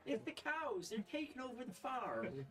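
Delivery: put-on very high, loud, tearful old farm woman; proper Frida voice. GM redoes Frida begging the party about the cows, very high. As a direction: pitch her way up and plead. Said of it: "That's not how she sounds, actually".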